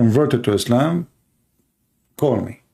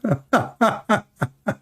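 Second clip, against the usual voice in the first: high-pitched run of laughter; 10 semitones higher, 3 dB quieter than usual. Real, not acted. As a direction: laugh in high, repeated bursts. Amused at saying brother.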